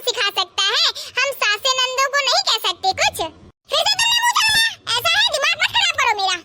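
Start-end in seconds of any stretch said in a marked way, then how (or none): 3.7-6.4 high-pitched angry scolding; angry screech. Acted. Mother-in-law warns her daughter-in-law not to talk back, screeching. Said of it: "इतना मत चीखिए".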